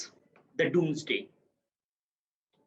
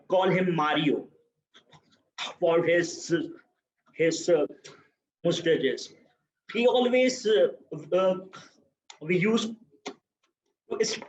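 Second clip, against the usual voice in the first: ordinary talk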